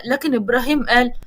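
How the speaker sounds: plain reading voice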